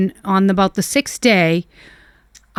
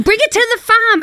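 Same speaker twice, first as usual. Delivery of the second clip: high, loud imitated yelling; mock yell; 6 dB louder than usual. Husband yelling to take the puppy away, high loud mock yell. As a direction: shout the order across the house. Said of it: "And he's yelling".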